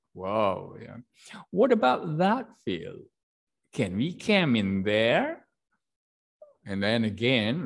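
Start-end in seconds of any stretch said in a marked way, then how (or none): 1.5-5.5 voicing Billy. Shown as a demonstration. Teacher voices Billy asking.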